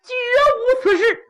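high, wailing denial to the emperor; an accused official cries out to his emperor; frightened accused minister. Acted. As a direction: plead innocence half in tears. Pan Renmei protests to emperor.

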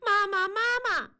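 put-on high child calling mom. A narrator's reading. Girl calling to her mother, high. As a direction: tug at her and cry out. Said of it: "喊".

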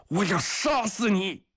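high-pitched furious threat; 格里高利, enraged shout. A narrator's reading. Soldier lunges at the guard, shouting in rage. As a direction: yell it while lunging at him. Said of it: "格里高利喊着朝他扑了过去".

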